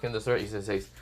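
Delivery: ordinary delivery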